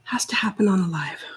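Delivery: whispered to herself. Real, not acted. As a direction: breathe it under the breath, annoyed. Muttering to herself at glitch.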